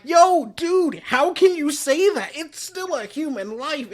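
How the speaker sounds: high, loud retold outrage; indignant protest. Ron scolds Shinichi for being numb to death, acted indignation. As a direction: protest as if appalled, easing off in volume at the end.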